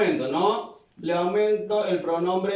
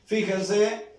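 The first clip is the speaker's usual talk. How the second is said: ordinary talk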